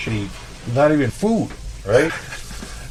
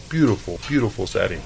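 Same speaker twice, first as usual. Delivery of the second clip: ordinary delivery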